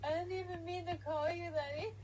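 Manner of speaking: laughing. Real, not acted. Laughing at her own name mix-up.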